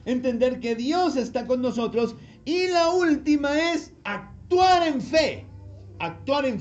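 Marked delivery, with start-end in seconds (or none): 2.4-6.6 slow, high, loud emphasis; triumphant final point; rousing climax. Real, not acted. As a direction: hit the last key hard. Climax of the list.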